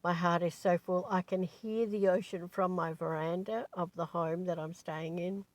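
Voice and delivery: ordinary talk, nothing marked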